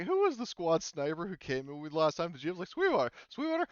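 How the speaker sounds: incredulous retold quote. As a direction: act out the player's disbelief, pitch jumping up on the name. Voices a player asking about Sweetwater.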